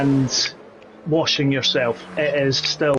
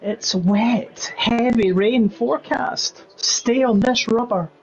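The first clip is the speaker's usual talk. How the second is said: higher put-on race engineer voice; high-pitched voice with a slower middle phrase; Gavley Pinkham voice; 8 semitones higher, slower than usual. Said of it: "every time she has said".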